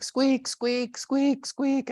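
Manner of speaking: high, squeaky car-noise imitation; imitated squeak. Describing his car's weird noise, high squeaking imitation. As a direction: squeak like a worn car part. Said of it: "it was making a weird noise".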